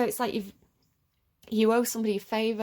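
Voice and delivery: ordinary talk, nothing marked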